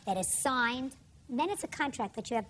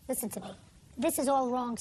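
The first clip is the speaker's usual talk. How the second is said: ordinary delivery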